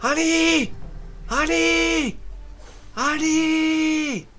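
high, loud sing-song call, fading. Acted. Streamer hollering a champion's name, playful.

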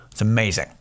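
normal talking voice

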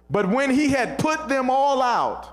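high, ringing preacher delivery; emphatic scripture preaching. Acted. Preacher declaring the verse to the congregation, emphatic and high. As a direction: build the verse up with force.

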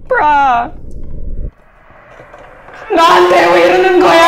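loud high rapid complaint; exasperated shout. Real, not acted. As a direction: yell in frantic disbelief. Chased, exasperated.

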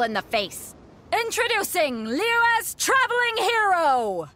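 high, slow, booming arena introduction; grand announcer. Acted. Announcer presenting the finalist to the roaring crowd. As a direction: build the hero up to a big reveal.

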